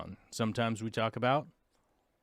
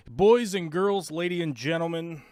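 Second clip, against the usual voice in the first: mock announcer; 5 semitones higher, 5 dB louder than usual. Host opening show like announcer.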